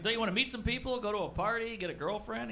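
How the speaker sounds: high, coaxing, pestering tone; pleading nudge. Retold or imitated. Uncle nagging nephew to make friends, high coaxing.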